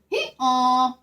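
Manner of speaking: a light hee-haw bray; mild donkey bray. Acted. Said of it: "brayed the donkey".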